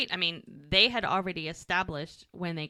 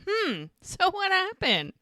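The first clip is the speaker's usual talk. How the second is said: probing Mel; 11 semitones higher, slower than usual. Retold or imitated.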